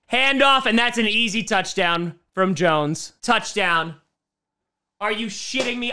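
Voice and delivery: normal talking voice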